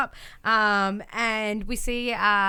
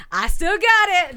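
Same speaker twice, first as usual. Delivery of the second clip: high, louder triumphant boast; gloating win; 8 semitones higher, 9 dB louder than usual. Retold or imitated. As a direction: crow about the win. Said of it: "And he's like".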